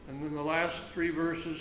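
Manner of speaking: usual speaking voice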